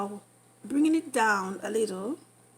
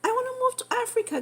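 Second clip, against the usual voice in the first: high, fast, giddy rush; fast high excited gush; 12 semitones higher, much faster than usual. Real, not acted. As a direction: rattle it off fast, can't contain it. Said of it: "let me calm myself down".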